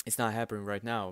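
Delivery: ordinary talk, nothing marked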